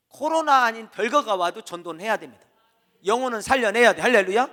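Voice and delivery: loud, driving sermon climax; full-force preaching. Acted. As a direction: press it home to the congregation.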